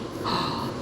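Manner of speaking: breathy little gasp of surprise; gasp, wide-eyed; surprised gasp. Acted. Little Bear gasps in surprise at the invitation. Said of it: "eyes opened wide in surprise".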